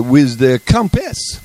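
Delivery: slow, lightly imitated French accent; a light French accent, drawn out. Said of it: "with a French accent".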